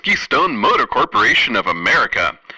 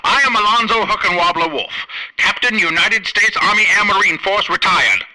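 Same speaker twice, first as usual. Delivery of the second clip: put-on raised-pitch pompous old officer introducing himself; pompous captain; 7 semitones higher than usual. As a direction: puff up like a retired army captain.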